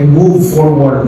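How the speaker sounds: slow repeated phrase. Shown as a demonstration. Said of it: "Pakisabi sa katabi".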